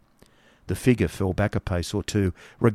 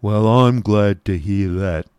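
slow, deliberate grim figure; grim genius; 4 dB louder, slower than usual. A narrator's reading. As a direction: draw it out grimly. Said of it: "looking very grim".

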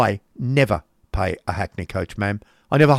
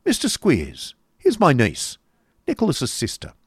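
raised, mildly sarcastic introduction; cold sarcasm; 6 semitones higher than usual. A narrator's reading. Uncle introducing niece to schoolmaster, coldly sarcastic. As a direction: present her with cool mock courtesy. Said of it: "in his cold, sarcastic manner".

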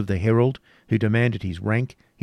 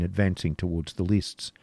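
plain reading voice

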